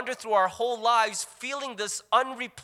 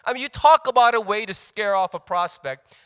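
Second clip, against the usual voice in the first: normal talking voice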